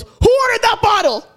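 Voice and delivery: high mock holler; imitated outraged shout. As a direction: yell it like he's charging across the room. Man runs over, demands who ordered.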